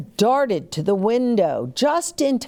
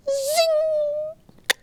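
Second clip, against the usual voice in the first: very high arrow zing; zing sound effect. Acted. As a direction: fling it up high like a flying arrow.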